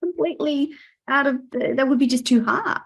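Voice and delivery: usual speaking voice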